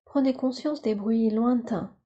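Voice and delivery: normal talking voice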